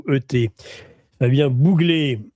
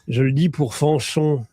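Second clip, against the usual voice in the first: ordinary delivery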